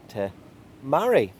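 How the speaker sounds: usual speaking voice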